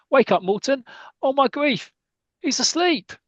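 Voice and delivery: very high-pitched horrified outburst; mock panic. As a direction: act out a manager aghast at his sleeping keeper. Manager panicking as keeper sleeps.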